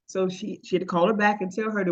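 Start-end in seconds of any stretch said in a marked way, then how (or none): none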